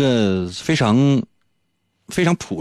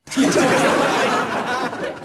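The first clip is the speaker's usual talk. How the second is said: high, breathy burst of laughter; laughing; 14 semitones higher than usual. Real, not acted. Host laughing at his own teasing, real laugh. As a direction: laugh at your own joke.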